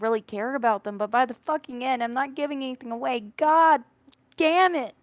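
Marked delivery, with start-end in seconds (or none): 3.4-4.9 exasperated shout. Real, not acted. Venting about the sad ending.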